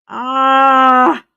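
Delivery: loud, drawn-out frustrated ah; loud groan of frustration; frustrated shout. Real, not acted. Trying to recall a name.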